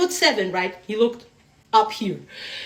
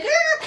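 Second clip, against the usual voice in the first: very high little scream; mock frightened yelp. Man fleeing from what he saw, mock frightened yelp. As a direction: imitate a squeal of fright. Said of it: "he skirted away".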